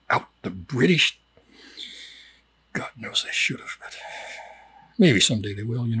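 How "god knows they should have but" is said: conspiratorial whisper, much softer; whispered aside. Acted. Teacher confiding a sly wish, whispered. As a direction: let it slip as a secret aside.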